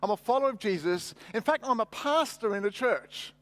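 usual speaking voice

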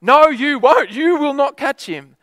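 high, loud disbelieving retort; mock retort. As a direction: scoff at the promise. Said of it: "I'm like".

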